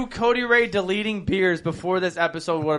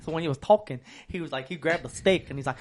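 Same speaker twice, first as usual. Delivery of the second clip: usual speaking voice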